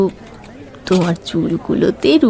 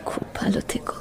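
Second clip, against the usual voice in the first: softer whispered goodbye; whispered farewell; 10 dB quieter than usual. Acted. Parting from her old love forever, whispering goodbye. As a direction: let the farewell fall to a whisper.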